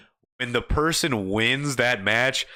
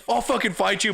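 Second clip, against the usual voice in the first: heated threat; 8 semitones higher than usual. Shown as a demonstration. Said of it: "but it's not like".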